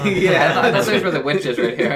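bursting out in laughter; laughing. Real, not acted. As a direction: laugh through the words. Cracks up at the joke.

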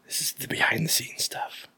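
hushed conspiratorial whisper. Real, not acted. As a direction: whisper it as a secret. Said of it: "Why are we whispering?".

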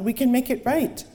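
high, loud, stammering panic; panicked. Acted. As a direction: plead in rising panic. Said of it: "Panic flooded him".

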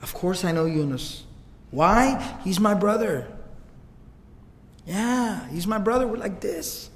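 soft, hushed reassurance; quiet fondness for a brother; tender. Retold or imitated. Prophet tenderly claims Yunus as brother.

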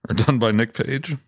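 ordinary delivery

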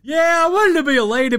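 high, loud mocking whine; hammed-up sulky player; mock Dinwiddie. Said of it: "mocking Dinwiddie".

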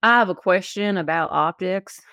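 put-on hillbilly twang; hillbilly accent. Co-host reads a listener's question aloud, hillbilly accent. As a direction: read it like a hillbilly. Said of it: "I'm gonna read it in my best hillbilly accent".

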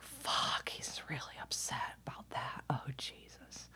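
hushed, whispery cursing to oneself; muttered regret. Retold or imitated. Mom realizing her son is upset, muttering to herself. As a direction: mutter it under the breath.

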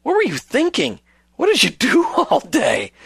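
high, incredulous scolding; mock exasperated husband. Host voicing a husband berating his wife, mock exasperated. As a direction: berate in staged disbelief, pitch leaping.

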